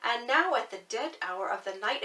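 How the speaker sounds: ordinary delivery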